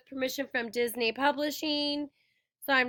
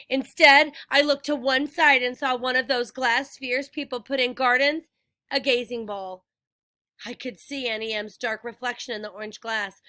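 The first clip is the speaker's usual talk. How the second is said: ordinary delivery, nothing marked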